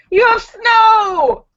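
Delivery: high, loud, drawn-out squeal; excited exclamation. Real, not acted. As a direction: cry out in amazement, stretching it. Exclaims at viewer's snowy picture.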